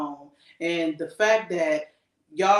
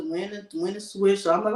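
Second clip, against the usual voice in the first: ordinary talk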